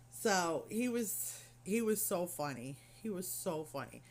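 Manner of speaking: ordinary delivery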